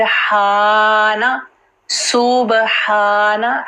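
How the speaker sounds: हाना with a long, held "haa"; drawn-out सुबहाना, every syllable distinct; clear "haa". Shown as a demonstration. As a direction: say सुबहाना slowly, never dropping the ha. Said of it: "हा अक्सर miss हो जाता है".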